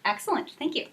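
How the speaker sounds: ordinary talk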